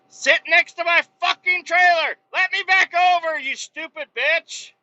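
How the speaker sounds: high, loud angry yell at the driver; road rage. Real, not acted. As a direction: snap at him, voice way up.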